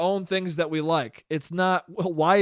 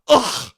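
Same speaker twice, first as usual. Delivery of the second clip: loud, higher disgusted "ugh"; mock scoff; 6 semitones higher, 5 dB louder than usual. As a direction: scoff like an offended fan. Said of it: "to have someone scoff at you".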